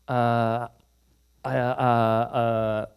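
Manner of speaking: slow, halting uh uh uh; stammering. Retold or imitated. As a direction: stall nervously for an answer. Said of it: "stumbling and stammering".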